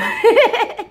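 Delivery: high burst of laughter; real high-pitched laugh. Real, not acted. Laughs at the teased stranger.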